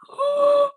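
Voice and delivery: loud, high gasp; shocked gasp. Real, not acted. Fan seeing the substitution, shocked gasp. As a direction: gasp in real disbelief.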